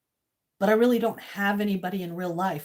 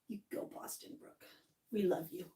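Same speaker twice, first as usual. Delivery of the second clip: breathy unvoiced little cheer; whispered cheer; 4 semitones lower, 17 dB quieter than usual. Real, not acted. Streamer whispering encouragement to viewer Boston Brooke. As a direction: whisper it as a fond aside.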